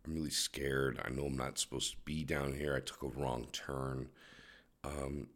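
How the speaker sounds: put-on soft, timid lost-kid plea to the cop; mock-meek voice put on for a cop. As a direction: play lost and scared, all innocent. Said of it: "that's my cop voice".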